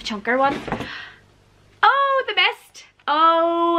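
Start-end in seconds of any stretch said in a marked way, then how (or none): 1.8-3.8 delighted. Real, not acted. Unboxing a wanted Stephen King.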